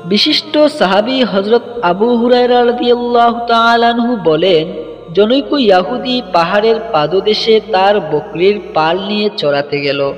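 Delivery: ordinary delivery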